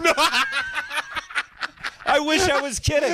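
laughing high through the words. Real, not acted. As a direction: giggle while admitting it. Laughing at his own confession.

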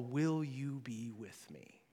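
slow, soft, low-pitched plea; hushed, unhurried, tender question; gentle invitation. Acted. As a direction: ask it quietly and let it hang. Voicing God's insistent call.

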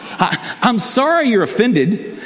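high, slow, sing-song fake apology with a laugh; mock a dismissive non-apology. Shown as a demonstration. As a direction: insincere apology, played for laughs. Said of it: "Those are not good apologies".

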